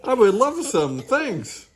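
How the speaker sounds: high, loud eager reply; boisterous high-pitched acceptance; calling out. A narrator's reading. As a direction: holler back happily. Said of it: "calls Bean".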